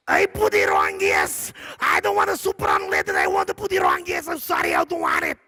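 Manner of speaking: put-on Russian accent, demanding at the counter; Russian lady.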